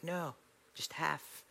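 quiet inner reply. Retold or imitated. Arguing with God in anecdote.

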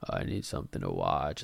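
mock your own listless scrolling in a low voice; zoned-out scroller. Podcaster imitating himself scrolling for videos, low zoned-out murmur.

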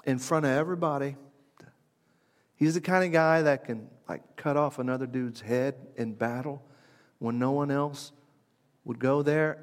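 ordinary talk, nothing marked

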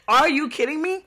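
mock outraged yell; incredulous. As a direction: throw it back at him in shock. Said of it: "I looked him in his face and I said".

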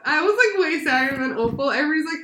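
half laughing, half crying as you say it; teary and high. Real, not acted. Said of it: "I'm so sad".

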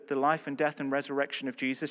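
normal talking voice

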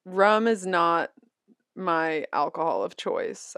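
usual speaking voice

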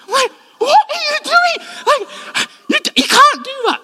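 very high, loud squeaky stammer; hammed-up horrified squawk; appalled disbelief. Husband seeing wife crochet at the match, appalled disbelief.

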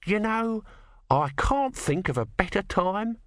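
high-pitched, pointed god voice; slow, high character retort; dry small god. Acted.

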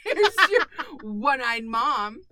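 drawn-out laughing tease. Real, not acted. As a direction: laugh it out as a joke. Joking mockery, laughing.

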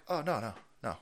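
calmly deflect like a tired manager. Pretend. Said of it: "And the guy's like".

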